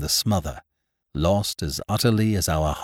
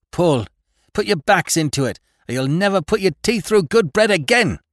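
high, loud shouted orders; harsh barking commands; 11 semitones higher, 5 dB louder than usual. Acted. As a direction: bark the orders at the rowers. Captain urges rowers before storm.

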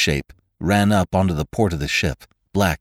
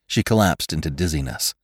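usual speaking voice

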